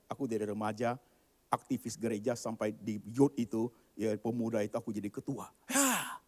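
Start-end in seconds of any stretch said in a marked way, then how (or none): none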